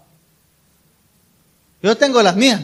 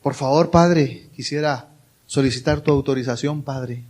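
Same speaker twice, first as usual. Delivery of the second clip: mock-formal, low and quiet petition; formal request; 7 semitones lower, 5 dB quieter than usual. Son soliciting his father's authorization, mock formal and subdued. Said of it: "normalmente uno no, no le dice".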